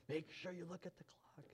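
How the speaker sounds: a hushed, breathy whisper reminder. Retold or imitated. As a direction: whisper it like a secret nudge. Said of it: "David's like".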